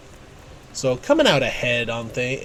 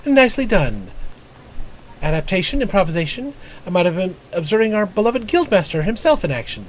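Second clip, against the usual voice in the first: higher, crisply formal mentor voice; formal guild strategist; 4 semitones higher than usual. Acted. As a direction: read it as a learned tactician addressing a pupil. Reads game mentor's dialogue aloud.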